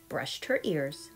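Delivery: usual speaking voice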